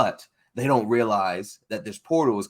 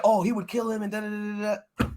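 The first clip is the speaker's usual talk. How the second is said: mock fan argument; 11 semitones higher, faster than usual. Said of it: "They're just like".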